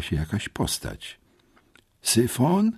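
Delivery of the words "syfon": high questioning call. A narrator's reading. Boy calls for his friend in the dark bushes, calling out. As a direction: call out uncertainly, pitch rising sharply. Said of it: "zawołałem".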